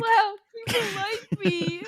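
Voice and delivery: high, loud, hammed-up tearful wail; mock choked up. Co-host playing touched at being invited, mock choked up. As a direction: play overwhelmed, half laughing, half crying. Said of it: "You got [name] all choked up".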